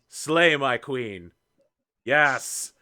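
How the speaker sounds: slow emphatic slay my queen; drawn-out slay. Acted. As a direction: stretch it out, fawning. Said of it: "and says".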